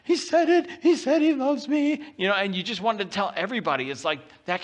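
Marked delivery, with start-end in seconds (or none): 0.0-2.0 squealing much higher and louder with joy; high, loud delighted squeal; excited parent. Retold or imitated. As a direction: gush like a thrilled parent who just heard it. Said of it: "and you're like".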